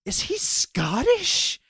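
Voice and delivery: imitating shock, very high and slow; gasp, then squeak out mock astonishment; astonished gasp. Girlfriend discovering the actor's real accent, astonished.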